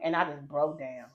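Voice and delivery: ordinary delivery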